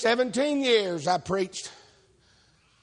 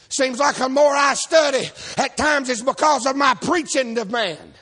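higher and louder, shouting the point home; preaching at full force; 4 semitones higher, 4 dB louder than usual. Real, not acted. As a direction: preach it to the back row.

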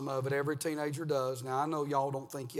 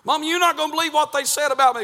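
put-on tattling kid; 10 semitones higher, 11 dB louder, faster than usual.

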